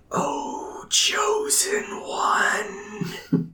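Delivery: breathy divine whisper; dramatic whisper. Acted. God speaks into a man's mind, dramatic whisper. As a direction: whisper it like a voice from the gods. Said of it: "a dramatic voice that goes".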